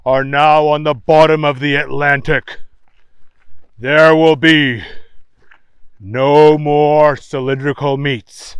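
loud grave newsman report; news reader. A narrator's reading. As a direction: deliver bad news like an anchor. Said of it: "said the newsman".

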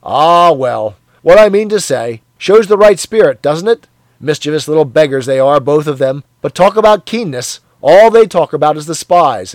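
loud, jovial bloke; a hearty colleague teasing; hearty Parsons. Acted. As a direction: boom it out matey. Parsons voiced in dialogue.

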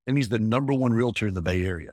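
usual speaking voice

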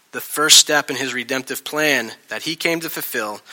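ordinary talk

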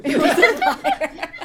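laughing loudly through the words; high, loud, drawn out through real laughter; cracking up. Real, not acted. Player repeats the punchline, cracking up laughing.

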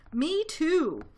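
high, loud copycat joey; young kangaroo. A narrator's reading. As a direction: pipe up eagerly in agreement. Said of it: "young kangaroo in her pouch said".

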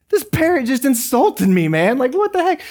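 high-pitched mock outrage; indignant customer. Man just insulted by a pet store parrot, comically indignant. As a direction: squeal in offended disbelief.

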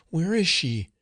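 high, frightened question; mildly trembling, high-pitched fear; trembling cry. A narrator's reading. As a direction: ask it in rising panic. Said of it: "she cried in a trembling voice".

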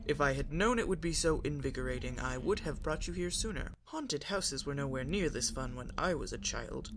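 lightly voiced gruff Klingon warrior; a hint of a proud Klingon father; Worf voice. A narrator's reading. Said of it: "Worf said with a small smile".

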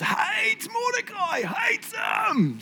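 mock-furious emphasis, rising to a shout; hammed-up hatred. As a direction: stretch out hates Mordecai like a cartoon villain's rage. Describing Haman's hatred.

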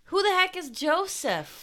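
high-pitched, incredulous demand; exasperated, high-pitched confusion; baffled outburst. Real, not acted. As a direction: burst out in total bewilderment. Baffled outburst at unknown character.

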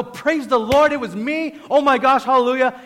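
high, loud hallelujah shouting; excited outburst. Acted. Preacher acts how the healed woman didn't react, excited outburst. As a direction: burst out in loud, high-pitched praise. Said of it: "burst out and go".

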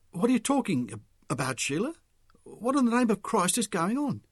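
high, agitated demand; worked-up, incredulous questioning; agitated bewilderment. A narrator's reading. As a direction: insist on an explanation, baffled. Bewildered agitated Mick demands an explanation.

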